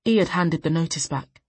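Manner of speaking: ordinary delivery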